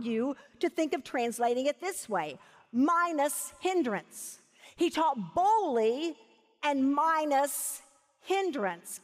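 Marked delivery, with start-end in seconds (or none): 2.7-9.0 slow, weighty phrases with pauses; emphatic teaching. Real, not acted. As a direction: stretch out MINUS each time. Drives home a translation.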